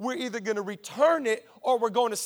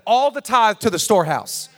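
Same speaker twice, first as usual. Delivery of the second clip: raised, ringing pulpit declaration; loud preaching; 4 semitones higher, 8 dB louder than usual. Real, not acted. Pastor booming scripture to the congregation, loud. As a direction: proclaim it to the whole church.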